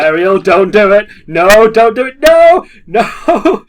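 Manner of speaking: high, loud mock begging; rising panicked no no no; hammed-up pleading. Begging Ariel not to sing.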